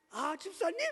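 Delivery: sudden high, put-on welcome; feigned friendly greeting. Pastor caught mid-quarrel greets a church visitor, feigned friendly. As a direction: switch on a sweetly hypocritical greeting. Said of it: "프리텐을 기가 막히게 잘해요".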